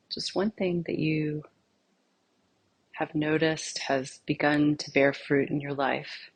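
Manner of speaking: soft, unhurried meditation voice with long pauses; guided meditation. Acted. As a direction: gently lead them inward, phrase by phrase, leaving silence between. Leads a guided meditation exercise.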